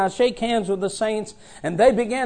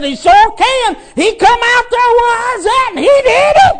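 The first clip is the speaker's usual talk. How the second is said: much higher and louder, triumphant; Peter's voice; 12 semitones higher, 6 dB louder than usual. Pretend. Peter telling the preacher how Jesus stopped the storm, high and loud. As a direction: crow it like old Peter telling his tale.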